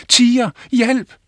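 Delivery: high, loud cry for help; boy shouting a false alarm. Acted. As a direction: yell it out to the village. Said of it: "råbte han".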